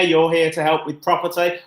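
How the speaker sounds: ordinary talk, nothing marked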